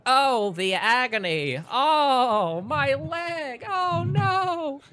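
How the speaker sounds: high, drawn-out mock wailing; feigned agony. Player fakes a broken leg for the guards, feigned agony. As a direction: ham up the pain, loud and very high. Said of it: "kind of start writhing".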